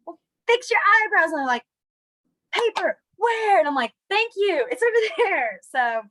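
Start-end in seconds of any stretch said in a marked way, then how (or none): 0.0-1.3 higher, louder sassy retort. Retold or imitated. Teacher telling a student to fix eyebrows, sassy. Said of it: "a little bit of a sassy teacher". 2.5-3.6 put-on high, slow whining kid; whiny kid. Kid redoing the sign question for the teacher, whiny. 4.1-5.6 higher, chirpy sassy answer. Retold or imitated. Teacher answering a student's corrected question, sassy. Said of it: "a little bit of a sassy teacher".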